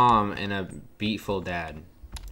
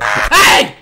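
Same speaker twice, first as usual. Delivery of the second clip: sudden, very loud scream of fright; startled yell; 19 dB louder than usual. Real, not acted. Player hit by a jump scare, startled yell.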